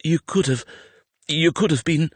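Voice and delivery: high, faltering voice; shaken distress. A narrator's reading. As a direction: break off before the awful thought. Shaken, cannot finish fearful thought.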